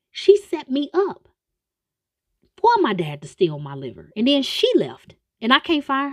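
loud, high outraged rant; high, loud mock-outraged complaint; indignant rant. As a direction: build up outrage about his stolen liver. John ranting in retold scene.

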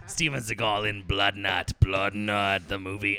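deep, slow trailer announcer voice; horror trailer voice. Pretend. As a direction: growl it like a movie trailer narrator. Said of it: "Let me do a movie trailer".

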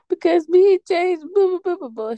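put-on high, whiny kid voice mid-sob; whiny crying kid. Telling mom about the fight, in a high whiny sob. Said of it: "And I was like".

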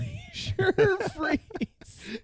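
high squeak through laughter; laughing. Real, not acted. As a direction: laugh out the name slowly. Mocking the drink's name.

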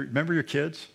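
ordinary talk, nothing marked

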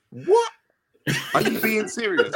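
very high-pitched, squeaky disbelief; incredulous. Retold or imitated. Player challenging coach's excuse, incredulous. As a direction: act out being utterly gobsmacked.

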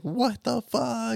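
high, slow stunned "what the"; shocked reaction. Retold or imitated. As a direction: imitate a stunned viewer. People reacting to Vader.

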